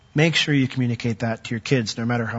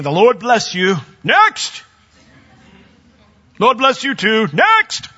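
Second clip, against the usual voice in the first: higher and louder, briskly waving people on; hammed-up assembly-line blessing that barks out next; mock dispassionate blessing; 9 semitones higher, 6 dB louder, slower than usual. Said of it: "I can't imagine Jesus dispassionately laying hands".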